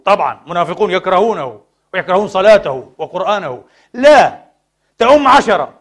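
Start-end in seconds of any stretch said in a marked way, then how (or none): 3.9-5.7 loud, high, forceful correction; emphatic objection. Real, not acted. Preacher correcting listeners about leading unwilling worshippers, emphatic raised voice. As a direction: burst out with the objection.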